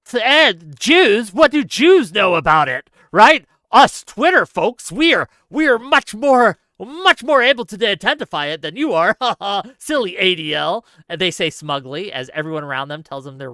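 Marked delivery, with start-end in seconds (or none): 0.0-2.9 loud, scornful mock critic; loud mocking impression of angry online critics. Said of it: "made the usual suspects very angry". 3.7-10.8 loud, higher smug mock voice; smug Twitter critic, loud and mocking; smug mockery. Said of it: "made the usual suspects very angry".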